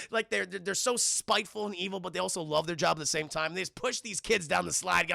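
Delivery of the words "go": ordinary talk, nothing marked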